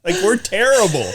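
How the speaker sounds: high, loud, laughing mock protest; hammed-up kid in disbelief; incredulous protest. Kid rejecting dad's praise after a lost game, incredulous.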